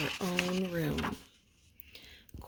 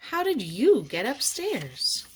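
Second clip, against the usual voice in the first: puzzled watchman; 5 semitones higher, 4 dB louder than usual. A narrator's reading. Watchman questions the bear.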